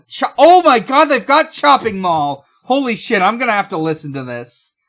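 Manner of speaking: thrilled at finding a beloved film; a real excited outburst that builds to a laugh; real excitement. Real, not acted. Finds a favourite film listed.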